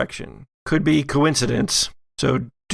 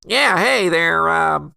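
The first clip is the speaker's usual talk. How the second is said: nasal deputy voice; 5 semitones higher than usual. Acted. Bumbling deputy greets the agent, pinched and nasal. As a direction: hold your nose and greet the agent. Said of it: "You, you pinched your nose".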